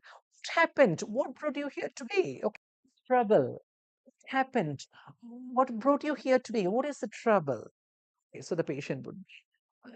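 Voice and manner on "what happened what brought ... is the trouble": caring nurse questions. Acted. Role-play nurse asking patient.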